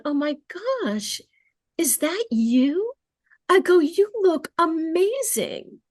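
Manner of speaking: put-on light up as if spotting an old friend; delighted surprise. Judge greeting a recovered defendant in court, delighted surprise.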